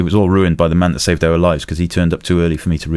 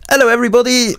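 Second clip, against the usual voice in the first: much higher, bright mock-cheery greeting; really cheery. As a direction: greet everyone like rescuing them is fun. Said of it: "Really, really cheery".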